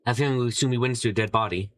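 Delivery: ordinary delivery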